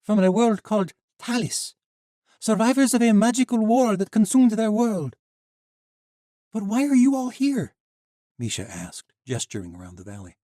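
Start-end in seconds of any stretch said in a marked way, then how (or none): 0.0-5.2 put-on young woman voice. Sheva speaking in the tale. 6.5-7.8 put-on young heroine voice. Misha questioning Sheva.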